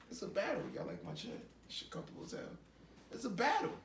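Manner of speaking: ordinary talk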